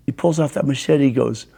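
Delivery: usual speaking voice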